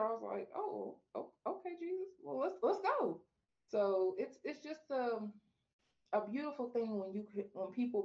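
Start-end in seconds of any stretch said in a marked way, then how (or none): 0.5-3.2 surprised acceptance. Retold or imitated. Acting her own surprised acceptance.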